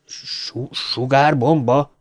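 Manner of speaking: slow stutter on the first syllable; stammering. A narrator's reading. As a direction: stumble into the word, then draw it out as a question. (dadogva, elnyújtva)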